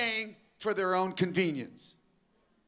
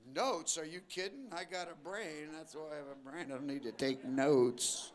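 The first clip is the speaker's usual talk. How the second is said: mock lazy student; 7 semitones lower, 6 dB quieter than usual. Preacher mimicking a slacker student, soft and lower. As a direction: brush it off like a bored teen.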